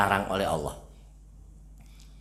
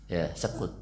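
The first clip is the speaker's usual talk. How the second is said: ordinary talk, nothing marked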